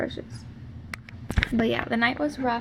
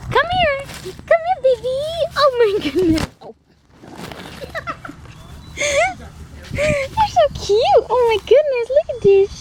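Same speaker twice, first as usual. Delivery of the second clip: high, loud puppy calling; 14 semitones higher, 4 dB louder than usual. Real, not acted. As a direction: call the puppy in sing-song.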